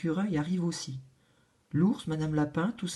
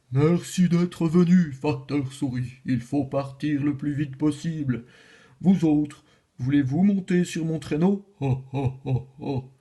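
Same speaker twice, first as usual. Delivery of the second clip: Father Christmas; 2 semitones lower, 3 dB louder than usual. Acted. Le Père Noël accueille la souris, voix joviale. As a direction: welcome them like a jolly Santa.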